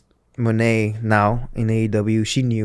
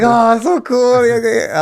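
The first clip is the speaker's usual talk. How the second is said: much higher and louder, overjoyed squeal; giddy delight; 12 semitones higher, 7 dB louder than usual. Retold or imitated. Recovering patient thrilled over a coffee trip, high excited gush. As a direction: gush like a kid at Starbucks after the hospital. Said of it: "made me happy".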